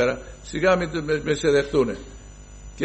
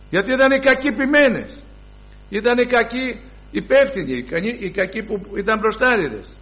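indignant denunciation; 10 semitones higher than usual. Real, not acted. Preacher denouncing the bad shepherds, high indignant cry.

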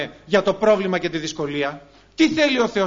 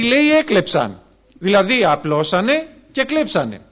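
fiery preaching; 2 semitones higher, 5 dB louder than usual. Acted. Preacher warning the brothers about theft, loud fiery preaching. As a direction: hammer the point home from the pulpit.

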